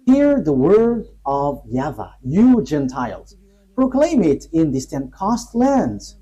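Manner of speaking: slow, ringing scripture reading; scripture proclamation. Real, not acted. Teacher reading Jeremiah to the Gentiles, slow solemn proclamation. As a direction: proclaim each phrase slowly, with long pauses.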